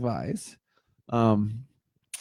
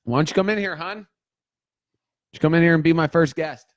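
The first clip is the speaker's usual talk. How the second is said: calling out; 5 semitones higher, faster than usual. Real, not acted. Calling wife from other room.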